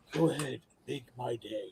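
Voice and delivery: low, quiet tough-guy imitation; mock movie threat, dropped low and soft; celebrity imitation. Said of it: "your fake celebrity answer machine message".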